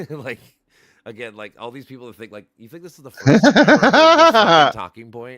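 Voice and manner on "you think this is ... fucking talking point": loud, high, laughing through the words; laughing disbelief. Retold or imitated. To friends about an old talking point, loud laughing disbelief.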